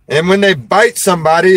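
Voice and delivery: usual speaking voice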